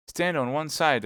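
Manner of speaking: urgent warning call; cried warning. Acted. Athos warns D'Artagnan before firing, crying out loud. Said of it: "cried Athos".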